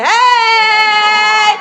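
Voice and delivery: high, loud held shout; parade whoop. Pretend. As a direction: whoop it out and hold it. Flaunting in the parade.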